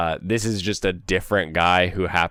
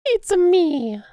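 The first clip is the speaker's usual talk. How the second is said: much higher cartoon plumber voice; Mario voice; 4 dB louder than usual. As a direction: pop it up high like the plumber. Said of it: "Mario voice".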